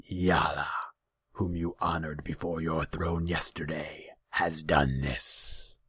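demon growl slowing to a drawn-out close; rumbling growl. A narrator's reading. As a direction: growl the revelation deliberately. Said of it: "His voice was a rumbling growl".

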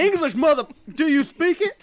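hammed-up demand; mock shout. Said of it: "mock movie line".

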